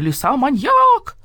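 loud, high, stretched-out cry; wailing yell. A narrator's reading. Rooster Petyunya denounces the fox, yelling high. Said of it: "завопил Петюня".

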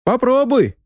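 very high-pitched character voice; Ryazana's voice. A narrator's reading. Companion threatened with being thrown down, high-pitched challenge. Hidden companion dares the narrator.

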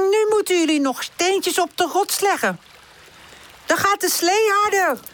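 high-pitched character call. A narrator's reading. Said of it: "roept Pip".

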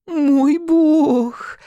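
high breathy fairy voice; pixie. A narrator's reading. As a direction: coo it like a tiny fairy. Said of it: "раздаётся за спиной томный голос фейки".

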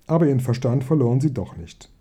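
usual speaking voice

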